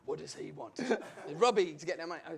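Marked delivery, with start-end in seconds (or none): 0.1-0.8 low, soft gruff biker voice; menacing biker. Retold or imitated. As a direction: voice the huge biker, low and quiet. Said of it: "He goes".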